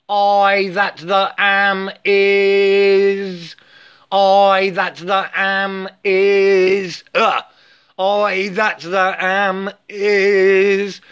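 each word drawn out very slowly; spoken mantra, stretched out word by word. Shown as a demonstration. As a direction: chant it slowly and deliberately, like a mantra. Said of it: "you have to learn to say".

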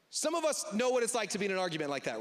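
usual speaking voice

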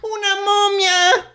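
shrieking high, panicked; terrified scream. Acted. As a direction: scream at a sudden ghost. Grandma appears in the dark.

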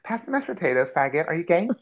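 high, blunt mock of the aggressive cousin. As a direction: pitch it way up and fire the question point-blank. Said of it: "she's like".

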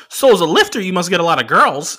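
the driver's voice. Retold or imitated. Comedian doing the driver's voice for the crowd, high and squeaky.

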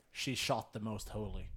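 low, soft, matter-of-fact delivery; very plain statement. Acted. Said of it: "just says very plainly".

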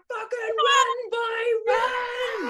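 put-on high shouted warning from an old nan; nan yelling at him. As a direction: yell like a gran telling the boy to run from the cops. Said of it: "she's like".

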